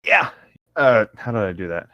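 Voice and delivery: ordinary talk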